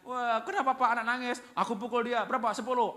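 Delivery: high, loud mock outburst; alarmed parents' exchange. Mother comes home to crying child, loud acted exchange. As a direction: play both parents at high volume, for laughs.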